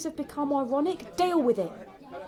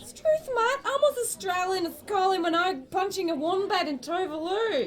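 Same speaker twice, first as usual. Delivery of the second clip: higher, hammed-up Aussie; silly Australian accent; 6 semitones higher than usual. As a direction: overdo the fake Australian accent. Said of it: "You can drop that silly accent".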